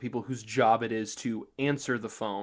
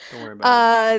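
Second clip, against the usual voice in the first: mock reassuring; 10 semitones higher, 13 dB louder, faster than usual.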